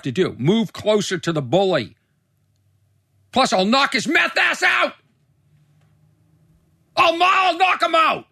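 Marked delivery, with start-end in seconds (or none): 3.3-8.3 much higher, louder mock-tough shout; fired-up threat. Real, not acted. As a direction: yell the threat, all bravado. Bravado about the meth guy.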